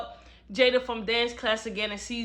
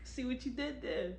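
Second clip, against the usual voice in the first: soft, slow knowing tease; sly hushed tease; 11 dB quieter than usual. Retold or imitated. Narrator reacting to Khalil's line, soft knowing tease. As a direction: murmur it like you caught the pun. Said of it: "I was like".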